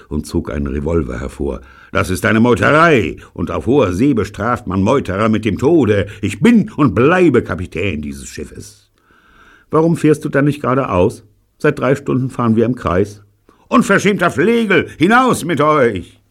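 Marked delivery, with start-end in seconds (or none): 1.9-8.9 loud, slowing menacing threat; threatening. Acted. Captain threatens with revolver. 9.7-13.2 high, loud defiant retort; cheeky retort. Acted. Crew retorts to captain. 13.7-16.2 furious. Acted. Captain throws them out.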